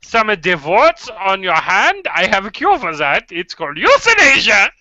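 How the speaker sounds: mock doctor with thick German accent; hammed-up German accent, selling the cure; cartoony German accent. Doctor examining warts on a hand, cartoony German accent. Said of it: "the most cartoony German accent".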